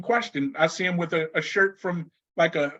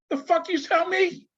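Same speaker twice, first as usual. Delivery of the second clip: much higher, incredulous mock outburst; shocked retort; 12 semitones higher than usual. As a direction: play the stunned disbelief. His shocked retold reaction.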